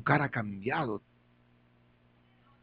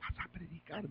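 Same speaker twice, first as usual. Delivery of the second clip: hoarse; 4 semitones higher, 19 dB quieter than usual. Retold or imitated. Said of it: "Hablaba así ronco".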